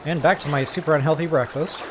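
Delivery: talking while crunching food. Real, not acted. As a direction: speak through crunchy bites. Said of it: "I wonder if you guys can hear me crunching".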